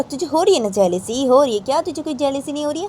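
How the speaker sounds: high, needling accusation; acted teasing. Chacha accusing Raima's abbu of jealousy, high acted teasing.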